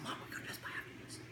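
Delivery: hushed whisper to mom. Retold or imitated. Kid whispering to his mom about shopping, secret whisper.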